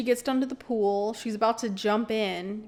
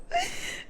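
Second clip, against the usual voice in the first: high breathy laugh; 5 dB quieter than usual. Real, not acted. Laughing at weird sentence.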